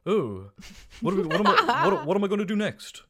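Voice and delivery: bad South African accent impression. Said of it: "That was my South African accent".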